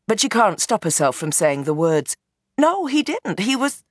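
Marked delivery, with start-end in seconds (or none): 2.6-3.8 high blurted denial; startled protest. Acted.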